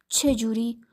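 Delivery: ordinary delivery, nothing marked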